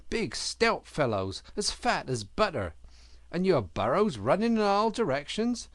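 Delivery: scolding lecture. Acted. Otter lecturing the rabbit about rescuing Mole, scolding. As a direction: pile on the reproach, then drop.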